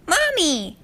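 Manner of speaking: high, bright little-filly call of Mommy. A narrator's reading. Little filly calling to Spitfire, high childish call. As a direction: call out like a small child spotting her mother. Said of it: "the filly said once more".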